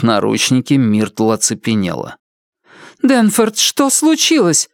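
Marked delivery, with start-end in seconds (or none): 3.0-4.7 much higher, slow and scared; a meek woman asking in fear; frightened high woman's voice; timid frightened wife. A narrator's reading. Myrtle voiced again.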